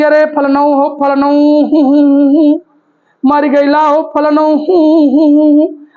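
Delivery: imitated keening with long-held हूँ; mock mourning wail. Teacher imitates women mourning a death, prolonged mock wail. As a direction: draw out a singsong lament, acted. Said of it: "ऐसे बड़ी देर तक रुदन".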